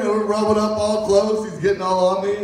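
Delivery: ordinary delivery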